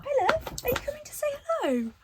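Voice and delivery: very high-pitched sweet-talk to a little one; cooing greeting. Real, not acted. Vlogger breaks off to greet a visitor, cooing high. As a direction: coo in a tiny, sing-song high voice.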